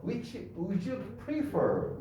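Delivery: Which would you prefer? said very slowly, weight on pre-FER; slow model sentence stressing prefer. Shown as a demonstration. Teacher to class, slow demo stressing prefer. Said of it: "여기서 강세가 어딨냐면은".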